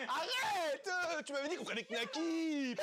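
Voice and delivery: high laughing outburst; laughing protest. Real, not acted.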